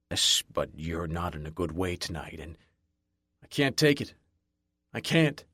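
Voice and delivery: lightly voiced bartender, low and careful; a hint of a cautious barman pushing back; wary bartender. A narrator's reading.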